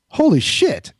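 high-pitched starstruck exclamation; amazed. Retold or imitated. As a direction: blurt it high, in retold astonishment. Retells his shock at the celebrity.

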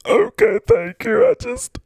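falsetto blubbering; crying character. Acted. Mister Golf thanks the host, then bawls over the playoffs. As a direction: break into a high, hammed-up wail. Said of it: "crying away".

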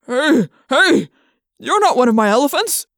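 loud panicked cry; alarmed shout. Acted. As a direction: yell as you're hoisted up. Trainer lifted by trunk.

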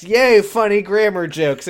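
high, loud mock-delighted yay; mock cheer. Host mocking the show's grammar joke, sarcastic cheer. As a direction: cheer it with exaggerated glee.

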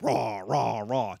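bulldog growl. Retold or imitated. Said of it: "You got the big bulldog".

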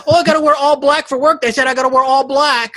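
high, loud mocking whiny voice; mocking whine. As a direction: mimic a whiny bar worker. Mocking a kitchen worker.

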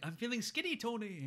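put-on high, loud accented Lars voice. Lars taunts Tony in a high put-on accent. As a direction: ham up the counselor's accent. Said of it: "Lars is like".

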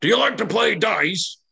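high, eager giant's call; yelled question. Acted. Giant asking the dwarves to play dice, yelling high. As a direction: yell it out high and guileless. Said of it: "Ock kind of yells out".

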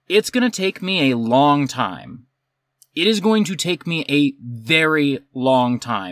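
slowly spelled-out warning. Retold or imitated. Barista warning a demanding customer of the wait, slow and deliberate. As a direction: draw it out for an impatient customer.

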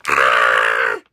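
harsh held creature scream; sustained rough cartoon scream; acted creature scream. A narrator's reading. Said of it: "screamed the deet".

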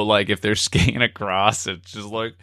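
ordinary talk, nothing marked

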